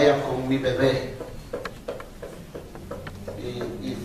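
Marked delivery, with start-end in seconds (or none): none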